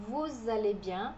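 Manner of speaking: Vous allez bien a bit slowly, vous with a pure u sound; Vous allez bien with the liaison, bien nasal; slow French demo. Shown as a demonstration. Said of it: "Aqui a gente tem o vous". (Vous allez bien devagar, vous com som de u)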